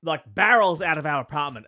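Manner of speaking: ordinary delivery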